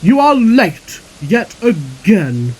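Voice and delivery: loud, slow, weighted rebuke; drawn-out telling-off from the boss; stern scolding. A narrator's reading. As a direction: spell out the offence slowly and crossly. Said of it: "snapped Sir Topham Hatt".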